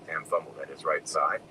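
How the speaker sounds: plain reading voice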